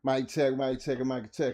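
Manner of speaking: loud, punchy mic check calls; calling mic check into the mic. Real, not acted. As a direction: test the mic with a loud call. Host testing the mic.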